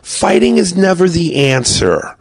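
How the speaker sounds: prim son. Retold or imitated. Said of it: "And he goes".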